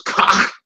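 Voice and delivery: "cock" with a throaty choking ending; mock the choked throat sound on "cock"; imitated choking pronunciation. Teacher imitating others' Hebrew pronunciation, "cock" choked in the throat. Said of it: "sound like you're choking on your food".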